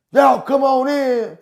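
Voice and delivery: high, loud drawn-out call; mock guard calling everyone in. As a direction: holler it across a yard, stretched out. Retold guard calling players inside.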